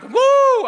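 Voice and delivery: loud high woo of excitement; sudden cheering whoop; excited whoop. Retold or imitated. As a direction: let out a big joyful woo. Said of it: "And I'm like".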